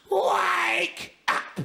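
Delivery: high, drawn-out yell; wake-up shout. Acted. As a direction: holler it high to rouse a sleeper. Captain wakes hungover sleeper.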